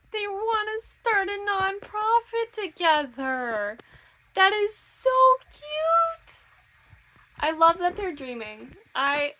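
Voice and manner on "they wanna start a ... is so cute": high-pitched, drawn-out gushing; real melting delight, climbing into a squeal. Real, not acted. Reader gushing over a couple's sweet plan, gushing.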